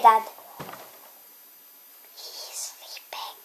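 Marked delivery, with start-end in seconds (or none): 2.2-3.4 whisper. Pretend. Child whispers to viewers that dad is sleeping. Teasing about dad asleep.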